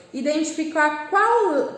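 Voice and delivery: ordinary talk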